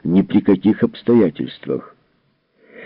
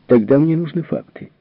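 curt retort; 7 semitones higher than usual. A narrator's reading. Calvin curtly demands facts from Bogert, higher voice. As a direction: cut him off, higher and sharp. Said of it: "отрезала она".

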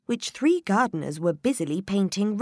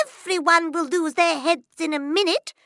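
high, loud threat; the Queen warning everyone; Queen threatening; 13 semitones higher, 7 dB louder than usual. Acted. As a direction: threaten the whole court.

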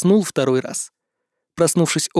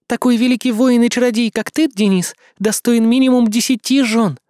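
put-on higher, gentle young woman's voice; princess Mei voice; 7 semitones higher, 3 dB louder than usual. A narrator's reading. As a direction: voice her as a sweet young princess. Character voice of Mei.

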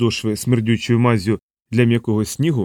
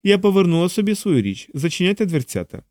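higher voice, understated coolness; cold woman; 7 semitones higher than usual. A narrator's reading. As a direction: dismiss with mild cool authority. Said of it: "холодно запитала Анжела". (холодно, вищим жіночим голосом)

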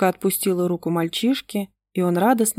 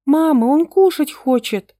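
put-on girl repeating herself louder and higher; 8 semitones higher, 6 dB louder than usual. A narrator's reading. Little daughter repeats to her parents, louder. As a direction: say it again, louder, so mum hears. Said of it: "повторила дочь погромче".

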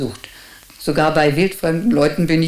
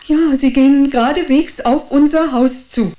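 high, louder and urgent; urgent alarm; 8 semitones higher, 4 dB louder than usual. Acted. Lookout tells the boy police are coming straight there. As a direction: report it in rising panic.

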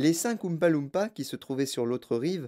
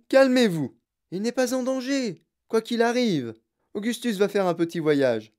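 higher-pitched, lively urging; insistent reassurance; 6 semitones higher, 4 dB louder than usual. A narrator's reading. Wonka calms the panicking mother, insistently reassuring. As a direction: insist brightly that all is fine.

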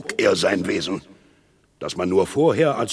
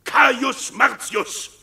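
hoch und laut, höhnisch geschrien; wütendes Anschreien; 12 semitones higher, 4 dB louder than usual. Acted. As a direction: den Namen dem Feind verächtlich ins Gesicht schreien. Aufidius schreit Coriolan an. (Aufidius schreit Martius voller Hohn und Zorn an)

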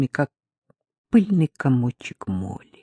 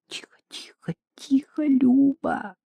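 шёпотом, срываясь на высокий приглушённый голос; уйми её, чтобы не услышали соседи; испуганное шиканье; 9 semitones higher, 3 dB quieter than usual. A narrator's reading. Испуганно унимает кричащую Любку. (тревожный шёпот, последнее слово протяжно)

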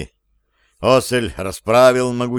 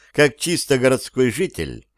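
usual speaking voice

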